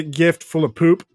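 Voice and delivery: normal talking voice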